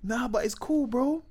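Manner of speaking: lightly voiced reassuring friend; imitating Nasty. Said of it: "Nasty goes".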